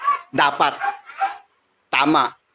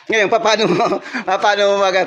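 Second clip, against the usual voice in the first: laughing; 6 semitones higher than usual. Real, not acted. Laughs mid-sermon point.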